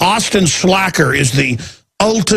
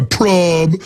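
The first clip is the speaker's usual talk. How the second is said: mock trendy slang. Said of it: "be even more trendy than him".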